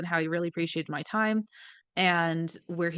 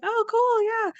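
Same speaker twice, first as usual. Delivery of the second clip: excited fans; 11 semitones higher than usual. Retold or imitated. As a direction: rattle off eager questions like a thrilled fan. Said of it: "They're like".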